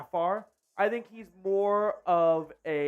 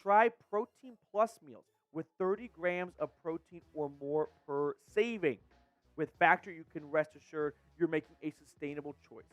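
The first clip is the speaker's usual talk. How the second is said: smooth, even ad-copy read; sponsor ad read; 3 semitones lower, 4 dB quieter than usual. Acted. Host reading the Factor Meals sponsor spot, ad read. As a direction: sell the meal service clearly.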